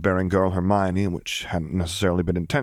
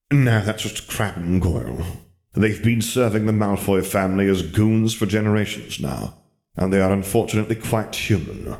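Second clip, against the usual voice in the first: sinister mentor. Acted. Wizard Lenin voiced.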